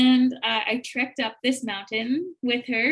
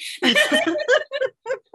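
high, loud laugh; real laugh; 7 dB louder than usual. Real, not acted. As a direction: burst out laughing at the punchline. Laughs after the punchline.

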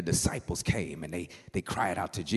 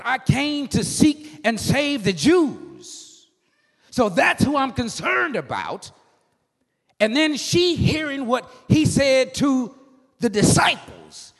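high, loud, shouted sung cadence; whooping preaching; 14 semitones higher, 9 dB louder than usual. Acted. Preacher building the woman's worship into a shouted whoop. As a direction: ride the tune up to a shout.

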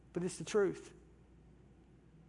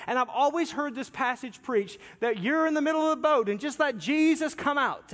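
ordinary talk

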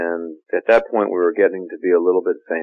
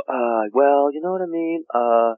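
pausing on "Uh, well," then rising high on "you know what I mean?" and trailing off on "Uh,"; hesitant American pauses; 8 semitones higher, slower than usual. Retold or imitated. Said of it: "like that. I don't hear English people pause like that".

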